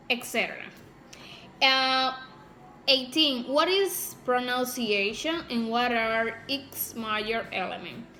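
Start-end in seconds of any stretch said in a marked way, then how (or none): none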